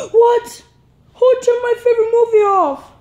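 high, startled cry of dismay; gasp, then protest his movie's off; shocked outburst. Acted. Kid finds movie switched off, shocked high cry.

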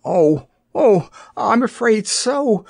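high, slow, pained gasps; gasping. A narrator's reading. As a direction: cry out and gasp the answer in distress. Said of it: "gasped the little girl".

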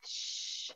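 "sh" as a long stream of air; spread tafashi. Shown as a demonstration. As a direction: sustain "sh" as an unbroken hush. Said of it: "Spread the sound of shin".

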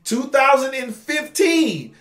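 high, loud, drawn-out disbelief; incredulous comic outburst; mock exasperation. Mock exasperation at sparse British series.

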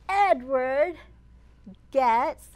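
loud, spaced-out words; dramatic pauses. A narrator's reading. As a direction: draw out the big moment. Dramatic reveal.

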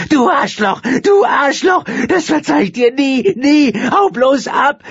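high screaming woman, crying as she lashes out; shrieking, sobbing fury. A narrator's reading. Woman shrieking at the man she thinks wronged her, crying. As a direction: scream it at him through tears. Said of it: "der kreischenden Frau".

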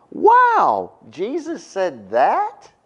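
high, drawn-out incredulous wow; mock astonishment. Preacher reacting to a verse he just read, mock astonishment.

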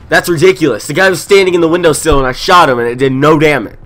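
loud, high indignant complaint; frustrated rant. Real, not acted. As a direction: vent it at full volume. Ranting about no damage.

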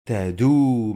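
تدو with the final oo drawn out loudly; ta-doooo, long waw held in تدو; long vowel waw. Shown as a demonstration. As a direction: stretch the oo at the end of تدو. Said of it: "مد بالواو".

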